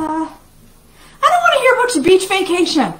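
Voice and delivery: high, loud pretend outburst; hammed-up, high-pitched complaint; mock jealous yell. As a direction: wail it in playful protest. Mock jealous outburst, then just kidding.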